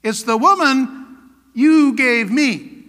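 slow, emphatic finger-pointing; accusing. Acted. Preacher repeating Adam's blame of God, accusing. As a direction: drag the words out to pin the blame. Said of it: "he's pointing his finger at God".

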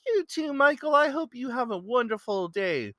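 very high-pitched, eager imitation; excited farewell. Young man wishing his new friend a good day, high and excited. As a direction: imitate a delighted fan returning good wishes.

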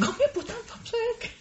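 high, soft, laughing squawk; mock indignant protest. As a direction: squeak a playful objection, half laughing. Mock protest at sister's insult.

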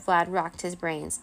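ordinary delivery

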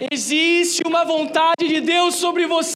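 loud, high booming prophet declaration. Shown as a demonstration. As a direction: proclaim it like a prophet. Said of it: "fala com voz de profeta aí".

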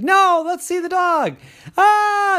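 high, loud cry; see the dog then shriek; high squeaky shout; eager then shrieking squirrel. Acted. Nosy squirrel then scared by chase.

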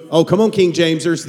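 ordinary talk

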